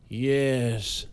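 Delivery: strange voice. A narrator's reading. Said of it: "said Banks in a strange voice".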